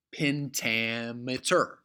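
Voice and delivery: slow, stretched "pen-TA-me-ter"; "pentameter" drawn out in separate beats; syllable by syllable. Shown as a demonstration. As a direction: sound out each part of "pentameter". Spelling out the term slowly.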